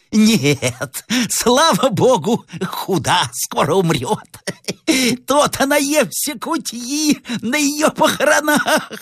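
high, hoarse, crazed relish; gloating madwoman. Acted. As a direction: gloat slowly in a mad old croak. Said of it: "сказала охриплым голосом". (полоумная злорадствует охриплым голосом)

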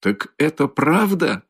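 high, slow question; astonished cry. A narrator's reading. Mother learning that Luzhin was driven out, exclaiming. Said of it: "вскричала Пульхерия Александровна".

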